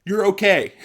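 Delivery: loud, high imitation of panicked kids; hammed-up panicky reassurance; mock panic. Panicked friends reassuring their bleeding buddy, mock panic. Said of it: "all my friends panicked".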